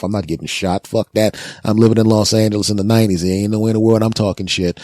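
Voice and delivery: ordinary talk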